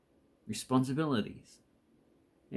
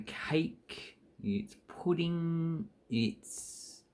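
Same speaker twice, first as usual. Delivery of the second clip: whispered, savouring; 4 dB quieter, much slower than usual. Real, not acted. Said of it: "dreamy dessert list".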